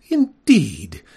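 a lightly voiced lady skunk, haughty and hurt; offended prim lady. Miss Mephitis rebuking the boy for a slur, haughty lady voice. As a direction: correct him with wounded dignity.

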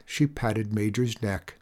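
ordinary delivery

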